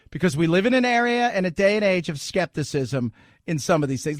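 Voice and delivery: normal talking voice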